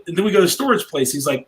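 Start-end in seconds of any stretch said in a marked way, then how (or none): none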